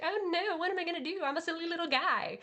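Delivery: high, squeaky mock-panicked whine; silly little guy voice. Imitating Vash fleeing the bounty hunters, high and whiny. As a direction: squeak it out like a helpless goofball. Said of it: "He's just like".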